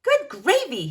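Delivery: mild shout. A narrator's reading. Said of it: "he shouts".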